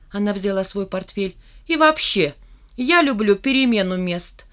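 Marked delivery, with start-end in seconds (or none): 1.7-4.5 defiant singsong. A narrator's reading. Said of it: "Почти весело, с вызовом пропела".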